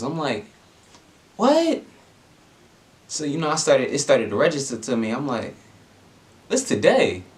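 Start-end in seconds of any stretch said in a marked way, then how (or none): 1.4-1.8 squeaky high disbelieving what; sky-high stunned outburst; shocked. Retold or imitated. April Fools reveal shock. 6.5-7.2 higher, disbelieving question; pitched-up stunned check; dawning realization. Retold or imitated. Realizing it's April Fools.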